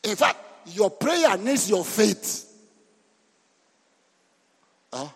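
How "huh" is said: usual speaking voice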